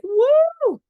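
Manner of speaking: very high, loud delighted cheer; thrilled kid. Retold or imitated. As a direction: whoop like an overjoyed child. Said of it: "This kid is like".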